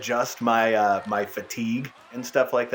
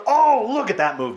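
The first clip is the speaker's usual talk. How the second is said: excited; 9 semitones higher, 4 dB louder than usual. Real, not acted. Commentator sees a slick move.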